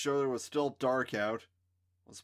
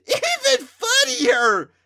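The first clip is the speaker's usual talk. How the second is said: high, loud, slow cackle; cackling; 17 dB louder, much slower than usual. A narrator's reading. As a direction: cackle it out gleefully. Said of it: "Candace cackled".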